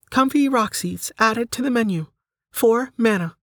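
high, measured system voice; menu announcement. Acted. Menu confirms Delta's new item, high announcing voice.

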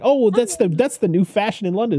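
high, chirpy reply about London fashion; mock-proper maid explaining the fashion; prim maid. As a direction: play the cheerful maid brushing it off. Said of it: "And it's like".

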